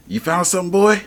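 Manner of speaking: dog handler. Retold or imitated. Hunter sends his dog sniffing the trail, high excited call. Imitates hunters talking to their dogs.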